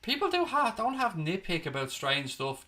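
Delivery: ordinary talk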